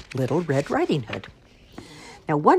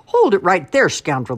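loud storybook farmer's challenge; indignant shout; 5 semitones higher, 10 dB louder than usual. A narrator's reading. Farmer grabbing wolf by suspenders, shouting. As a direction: yell to stop a scoundrel. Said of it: "the farmer shouted".

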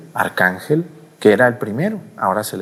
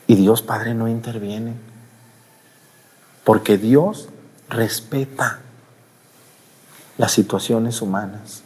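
ordinary delivery

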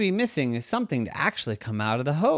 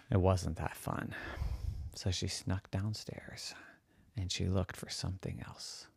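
much softer, low secretive telling; hushed narration; 7 semitones lower, 14 dB quieter than usual. A narrator's reading. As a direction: hush it down, sneaking. Narration drops to hush.

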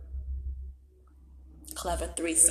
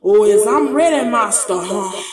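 loud mock taunt; 9 dB louder than usual.

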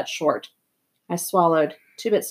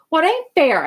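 high, impassioned protest; passionate cry; 9 semitones higher, 4 dB louder than usual. A narrator's reading. Boy protesting to his friends, passionate. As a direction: burst out against the injustice. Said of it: "I cried passionately".

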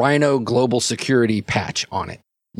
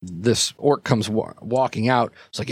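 normal talking voice

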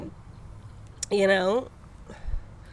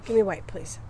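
whispering; 3 semitones lower than usual. Real, not acted. Asks quietly during recording.

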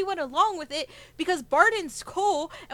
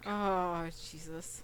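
much lower, trailing into silence; the dread sinks in; hushed horrified realization; dismayed gasp; 12 semitones lower, 14 dB quieter, much slower than usual. Real, not acted. Player recognizing feared name.

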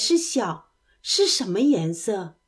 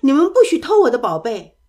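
higher, louder cry of "go away"; squirrel shouting; 6 semitones higher, 6 dB louder, faster than usual. A narrator's reading. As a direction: shoo them off from his acorns, loud and high. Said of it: "松鼠叫起来说".

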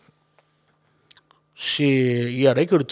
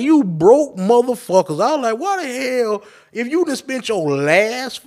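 exasperated disbelief; 9 semitones higher than usual. Retold or imitated. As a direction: replay your appalled reaction, pitched well up. Acted reaction to the GoFundMe pleas.

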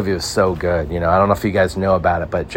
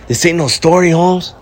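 Tom Hanks as street thug; 10 semitones higher, 3 dB louder than usual. Retold or imitated. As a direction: squeak out a silly gangster intro. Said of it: "You think Tom Hanks is gonna take a role on as a... street thug".

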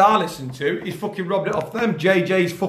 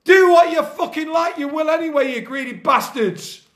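high angry telling-off; furious rant at the scouts; raging; 6 semitones higher than usual. Real, not acted. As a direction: spit it at the greedy scouts. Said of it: "I'm fucking raging".

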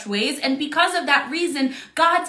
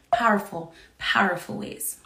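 softer hushed powerful ways; whispered emphasis; 6 semitones lower, 5 dB quieter than usual. Acted. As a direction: breathe the repeat. Trails into whisper on repeat.

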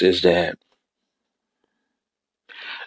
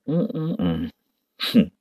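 normal talking voice

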